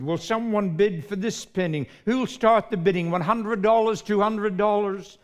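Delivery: loud, high bid calling; auctioneer calling. Acted. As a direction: call out for bids like an auctioneer.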